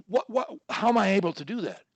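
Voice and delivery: normal talking voice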